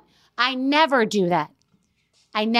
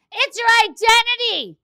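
higher, louder, insistent housekeeper voice; imitating housekeeper; 10 semitones higher, 6 dB louder than usual. Housekeeper telling her boss, insistent imitated voice. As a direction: press it like the most important thing on earth.

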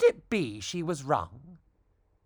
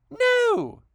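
high, loud squeaky no; resigned squeak; 6 dB louder than usual. Acted. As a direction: squeak it out, giving in. Vera answers, resigned.

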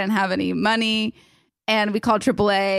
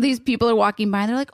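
ordinary talk

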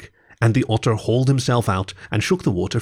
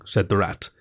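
ordinary delivery

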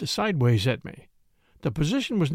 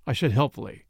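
ordinary delivery

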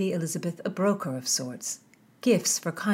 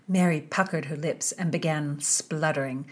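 ordinary delivery

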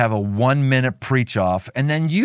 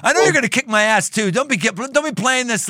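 usual speaking voice

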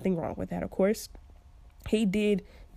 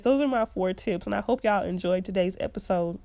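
ordinary talk

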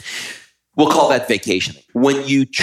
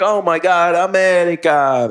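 high, thrilled exclamation of imitated locals; mock-amazed foreigner greeting Americans; amazed Italians; 7 semitones higher than usual. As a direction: gush about America like a starstruck local. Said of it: "people used to be like".

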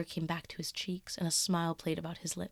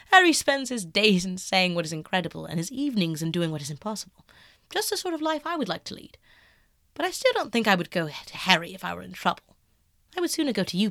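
eager Dorian; 7 dB louder than usual. Acted. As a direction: press it, protesting. Dorian voiced, loud high.

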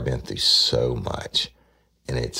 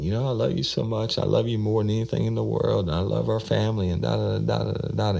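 high, teary imitation running on into da da da; imitating her sobbing love plea, higher; mock crying; 10 semitones higher, faster than usual. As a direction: keep her weepy high voice going, hurried. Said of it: "She started crying shit again".

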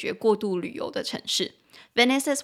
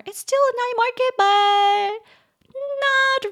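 much higher, louder silly voice; mock squeaky voice; 6 dB louder, slower than usual. Teacher jokes to students in a much higher silly voice. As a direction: squeal it like a joke.

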